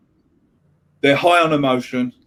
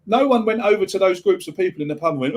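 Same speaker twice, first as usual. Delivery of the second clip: ordinary talk, nothing marked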